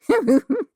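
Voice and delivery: bright high chuckle; a quick amused laugh. Acted. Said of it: "and chuckled".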